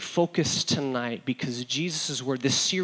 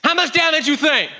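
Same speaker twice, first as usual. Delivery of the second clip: high, loud mock-furious shout; angry dad; 12 semitones higher, 5 dB louder than usual. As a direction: imitate an irate father yelling. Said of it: "he would be quite angry".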